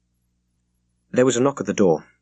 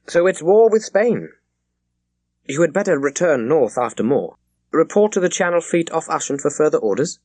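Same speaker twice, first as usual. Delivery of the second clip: higher, louder commanding admiral; brisk admiral; 8 semitones higher, 4 dB louder than usual. Acted. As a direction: give orders like a senior admiral. Admiral voiced by narrator.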